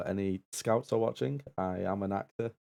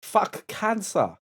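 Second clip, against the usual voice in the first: loud, high, slow attempt at a Norwegian accent; 11 semitones higher, 6 dB louder, slower than usual. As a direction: shout it in a badly faked Norwegian accent. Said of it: "in a Norwegian accent, please".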